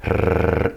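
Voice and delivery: sustained tongue trill on its own; long rolled R. Shown as a demonstration. Teacher makes the sound without a word, long trill. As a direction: hold out a bouncing rrr. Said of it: "make the sound without a word".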